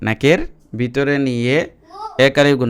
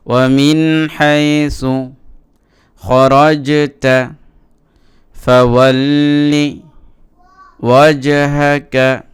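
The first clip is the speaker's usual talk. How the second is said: slow teaching recitation; 2 semitones higher, much slower than usual. Shown as a demonstration. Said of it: "র লাম জবর রল".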